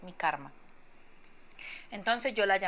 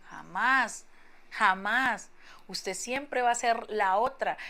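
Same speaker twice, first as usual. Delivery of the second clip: emphatic; 2 semitones higher, 6 dB louder, much slower than usual. Real, not acted. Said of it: "rechaza la idea con fuerza".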